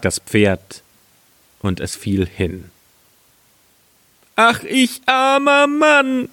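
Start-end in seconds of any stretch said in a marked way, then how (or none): 4.4-6.3 high, drawn-out mock wail; wailing lament. Carter finding his wine lost, wailing in high voice. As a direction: bemoan your bad luck, stretched out high.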